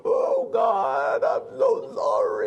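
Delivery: high, loud wailing, hammed-up plea; mock panicked apology. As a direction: screech a fake frantic sorry to God.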